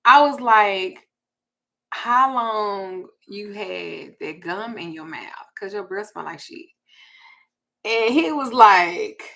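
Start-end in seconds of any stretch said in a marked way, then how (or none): 1.9-6.7 slow deliberate repeat. Retold or imitated. Repeating the question slowly to the man with bad breath. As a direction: spell it out slowly, word by word.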